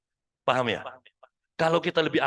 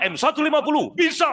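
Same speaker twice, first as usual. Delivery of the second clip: energetic ad line; 8 semitones higher, 6 dB louder than usual. Shown as a demonstration. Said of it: "Kita harus bilang apa?".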